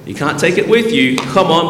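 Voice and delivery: ordinary delivery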